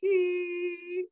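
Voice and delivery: very high, thin sustained "ee"; imitated TV static tone. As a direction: mimic the hum of a TV left on. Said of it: "when it would just be fuzzy".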